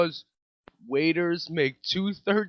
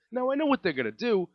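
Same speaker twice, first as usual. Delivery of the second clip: ordinary talk, nothing marked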